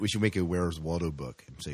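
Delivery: ordinary talk, nothing marked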